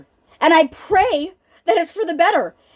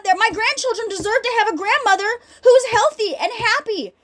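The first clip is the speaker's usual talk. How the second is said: ordinary talk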